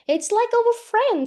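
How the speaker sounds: high, loud, drawn-out objection; pleading protest. Retold or imitated. Student defends dogs to housemate, pleading retold protest. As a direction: act out an appalled plea, slowly.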